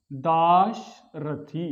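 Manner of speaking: Dasharathi drawn out very slowly with a short final इ, rising; teacher spelling the correct form aloud; very slow demo. Shown as a demonstration. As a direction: stretch Dasharathi syllable by syllable, short इ at the end. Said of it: "ह्रस्व ई का प्रयोग".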